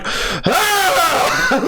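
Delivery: high, strangled cry of despair; anguished cry. Retold or imitated. The dad breaking down in front of his daughter, high wail. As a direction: let out a broken man's wail. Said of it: "rant mode of a broken man".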